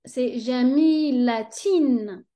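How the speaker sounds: jamilatin said very slowly, ending in a clear tin. Shown as a demonstration. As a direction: stretch jamilatin out. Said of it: "c'est in".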